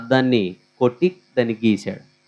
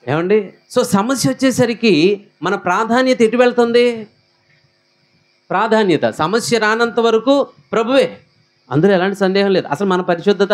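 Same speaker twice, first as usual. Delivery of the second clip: much higher, driving pulpit voice; full-force preaching; 7 semitones higher than usual. Acted. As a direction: preach it hard to the congregation. (ఉత్సాహంగా బోధిస్తూ)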